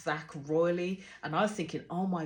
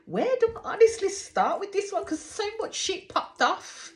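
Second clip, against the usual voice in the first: high, loud, wound-up outburst; exasperated disbelief; 12 semitones higher, 5 dB louder than usual. Real, not acted. Recapping a wild episode to viewers, high exasperated outburst. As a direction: throw your hands up in disbelief.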